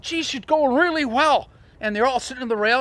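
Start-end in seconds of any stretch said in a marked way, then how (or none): 0.0-1.5 high, loud quoted praise from the crew; crew's praise. Retold or imitated. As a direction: call it out like cheering crewmates. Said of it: "they were saying".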